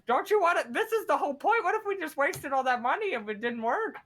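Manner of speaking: high, whiny protest; pleading character. Acted.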